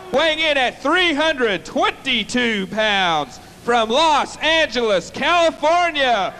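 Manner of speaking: drawn-out ring introduction, name held long; formal wrestling introduction building to the name; ring announcer. Real, not acted. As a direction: announce the fighter to the arena, stretching the final name.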